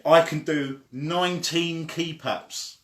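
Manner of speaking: plain reading voice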